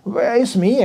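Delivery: usual speaking voice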